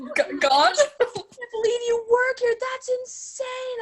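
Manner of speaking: high, slow, squealing amazement; imitating a shocked gushing friend; excited disbelief. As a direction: gush like a stunned old friend. Retelling excited stranger at work.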